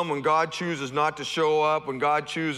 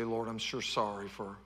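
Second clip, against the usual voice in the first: contrite apology; 5 semitones lower, 11 dB quieter than usual. Retold or imitated. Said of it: "we may have to go back and say".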